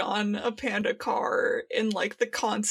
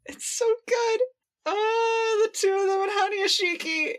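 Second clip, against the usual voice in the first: high, excited fan squeal; gushing; 10 semitones higher than usual. Real, not acted. Fan gushing to co-host about the brothers, high squeal. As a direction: swoon over the pair.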